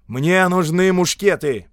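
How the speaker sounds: slow, loud, high demand; громкий оклик. A narrator's reading. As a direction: call out for attention. Visitor calls for clerk's attention. (громкое настойчивое требование)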